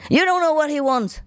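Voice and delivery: high, indignant scolding voice; possessive secretary. Retold or imitated. German secretary scolding the speaker, high indignant voice. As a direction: put the newcomer firmly in her place. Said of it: "secretary scolding the speaker".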